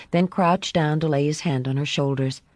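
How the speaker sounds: usual speaking voice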